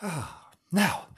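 ordinary delivery, nothing marked

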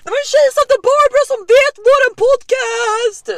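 very high, loud squealed announcement; excited screaming. Retold or imitated. Friend tells the whole apartment about the fan, screaming. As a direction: shriek the big news. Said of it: "Och jag börjar skrika".

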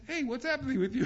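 high, loud puzzled teacher's question; imitating the Rebbe. The Rebbe asks his beaming student what's up, high and loud. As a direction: play the amused Rebbe catching a dreamy student. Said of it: "My Rebbe looks at me and says".

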